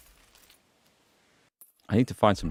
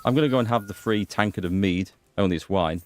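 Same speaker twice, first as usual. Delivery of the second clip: ordinary delivery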